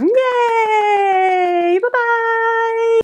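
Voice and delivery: put-on high, bright, sing-song presenter voice for little kids; over-the-top cheerful children's teacher; kids' show host. Shown as a demonstration. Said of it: "you feel really silly when you're doing it".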